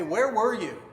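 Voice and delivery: high, loud accusatory question; accusing. Shown as a demonstration. Husband demonstrating inflection on his wife, accusingly. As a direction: fire it out as an accusation. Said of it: "Do you hear the inflection in my voice?".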